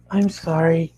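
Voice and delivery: put-on high-pitched girl's voice, apologetic; timid apology. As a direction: say sorry in a small girl's voice. Apologetic girl Pinky to narrator.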